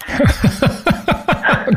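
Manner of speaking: airy high-pitched laugh; high breathy laugh. Real, not acted. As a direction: let out a quick breathy laugh. Laughs at dirty laundry remark.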